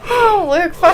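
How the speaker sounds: ordinary talk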